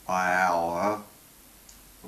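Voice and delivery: closed vowels blended into one loud, gliding sound; vowels run together. Shown as a demonstration. Teacher demonstrating the cycle, vowels run together. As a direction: glide through all the vowels without a break. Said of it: "pronounced all together".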